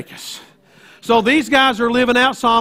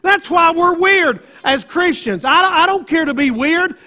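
full-force preaching; 6 semitones higher than usual. Acted. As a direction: preach it out to the back row.

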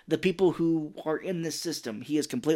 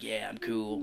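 low, soft too-cool voice; lightly mocked nonchalance; 4 semitones lower, 6 dB quieter than usual. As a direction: play it cool, quietly and low. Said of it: "trying to pretend to be a young man".

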